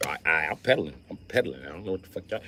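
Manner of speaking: normal talking voice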